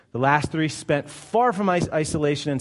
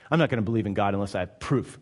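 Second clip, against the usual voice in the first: mock sceptic; 8 semitones lower, much faster than usual. Quotes a sceptic demanding proof.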